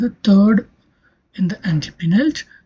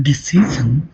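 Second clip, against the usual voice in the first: "decision" stressed on "ci"; stress before the suffix; 4 semitones lower than usual. Shown as a demonstration. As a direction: lean on the middle "ci" of "decision". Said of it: "the stress falls on".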